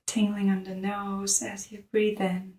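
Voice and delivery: soft, hushed meditation voice; softly led breathing practice; guided meditation. Real, not acted. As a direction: guide them gently into the breath, with long pauses.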